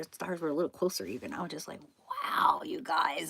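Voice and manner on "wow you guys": slow, hushed wow; awed whisper. Retold or imitated. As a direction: breathe it out in slow wonder. Awed by the close stars.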